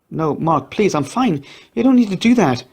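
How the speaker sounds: pleading. A narrator's reading. Pleading not to go back.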